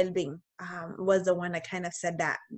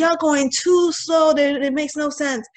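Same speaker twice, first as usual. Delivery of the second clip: higher, louder and slow, fed-up griping at slow drivers; impatient complaint; 8 semitones higher, 7 dB louder, slower than usual. Retold or imitated. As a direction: whine it out like you're stuck behind a slowpoke.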